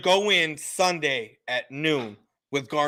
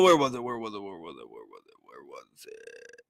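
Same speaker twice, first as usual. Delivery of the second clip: fading mutter; 9 dB quieter than usual. Real, not acted. Muttering while searching screen.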